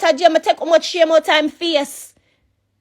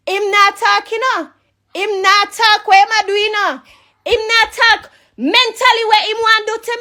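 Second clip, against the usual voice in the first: much higher, insistent, hammering "nah" and "talk"; heated rant; 7 semitones higher than usual. Real, not acted. Complaining about the man who won't talk, rising heated anger. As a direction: work yourself up, each line more worked up.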